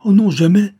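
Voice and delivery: high, loud cry of denial; alarmed outcry. A narrator's reading. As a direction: blurt out a panicked refusal. Vicomte cuts off Saphir's guess.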